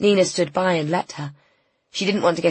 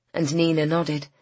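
usual speaking voice

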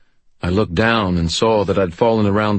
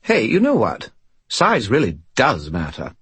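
smug; 4 semitones higher than usual. Acted. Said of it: "his voice was very smug".

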